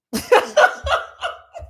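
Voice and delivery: loud high burst of cackling laughter. Real, not acted. Laughing at her own boast to viewers, cackling.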